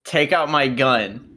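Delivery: normal talking voice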